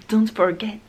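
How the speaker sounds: hammed-up English accent on "Don't Forget"; exaggerated English accent. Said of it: "quel accent".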